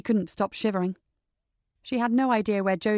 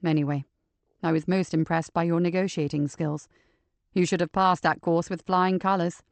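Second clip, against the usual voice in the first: low, steady man's voice; measured, deep detective voice; calm male detective; 4 semitones lower than usual. Acted. Joseph's voice.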